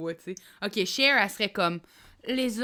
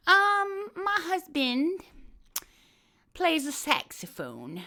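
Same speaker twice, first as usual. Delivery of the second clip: slow, higher mock Southern lady; Hillary Clinton impression; 8 semitones higher, much slower than usual. Doing Hillary for a friend, slow mock drawl. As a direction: drag it out like a Southern belle. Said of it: "Hillary Clinton".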